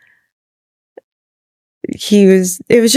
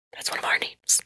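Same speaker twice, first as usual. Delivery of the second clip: whispered, hushed, like a secret; 16 dB quieter than usual. Retold or imitated. Telling her husband the name is theirs, whispered. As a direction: whisper it so no one hears. Hushing husband about baby names.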